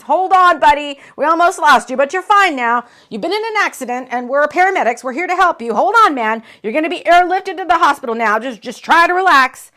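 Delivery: loud and high, a rescuer talking someone through; loud urgent paramedic. Acted. Paramedic urging the injured singer to hold on, loud and urgent. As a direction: keep him with you, urgent and loud.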